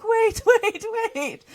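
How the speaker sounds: high, loud squawking plea; frantic calling out. Acted. Frog calling after the fleeing princess, frantic and high. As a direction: yell after someone running off.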